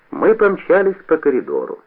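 ordinary delivery, nothing marked

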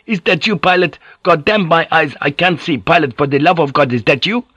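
high, urgent disbelief; choked out. A narrator's reading. Drunk who can't see his lost pilot, choked out. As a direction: plead in a high, overwrought voice. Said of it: "he choked out".

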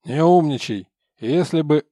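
loud, cutting reprimand; snapping guard. Acted. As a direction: shut him down hard. Надзиратель обрывает заключённого.

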